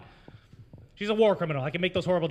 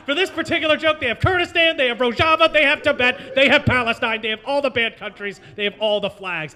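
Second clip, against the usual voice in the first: high and loud, easing down toward the end; listing them off with building glee that winds down; excited list; 6 semitones higher, 7 dB louder than usual. Real, not acted. Comic listing flag emojis to the crowd, excited then settling.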